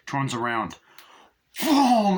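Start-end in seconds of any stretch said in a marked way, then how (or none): 1.6-2.2 loud sudden boom, high and sharp; shouted boom. Retold or imitated. Retelling the attack, a loud shouted boom. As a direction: yell the impact out big.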